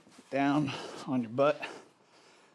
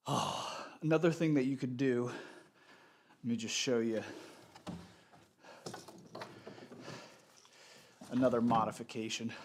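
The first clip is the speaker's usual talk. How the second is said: stuffy nose. Real, not acted. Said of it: "Oh, I'm so stuffy".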